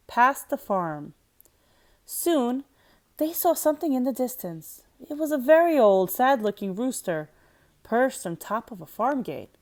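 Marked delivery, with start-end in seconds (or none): none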